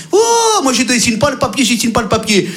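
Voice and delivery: high outraged yelp, then grumbling; a stubborn customer refusing to sign; exasperated customer. Acted. Client refuse de signer. (client exaspéré, cri aigu)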